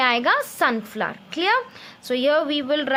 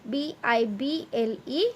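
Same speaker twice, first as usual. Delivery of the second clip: softer, letter-by-letter spelling of Bible; spelled out slowly; 4 semitones lower, 5 dB quieter than usual. Shown as a demonstration. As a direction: read the letters out one at a time. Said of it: "in this cloud we will write".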